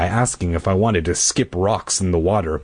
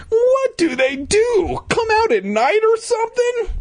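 put-on child hero voice. Hero asking Louisa about ghosts, squeaky kid voice. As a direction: squeal the question, drawing it out.

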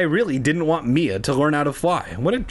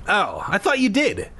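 puzzled, higher-pitched reply; 7 semitones higher than usual. A narrator's reading. Wilson to Jack about the tennis, puzzled. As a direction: sound thrown by the answer. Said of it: "he said, puzzled".

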